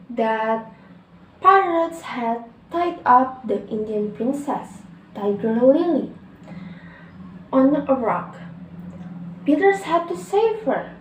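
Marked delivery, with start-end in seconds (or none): none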